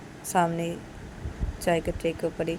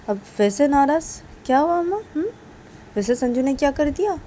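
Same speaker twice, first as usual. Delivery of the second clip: higher, louder mother's questioning; concerned questioning; 7 semitones higher, 4 dB louder than usual. Acted. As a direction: ask in raised-pitch, mild alarm. Mother demands what son did.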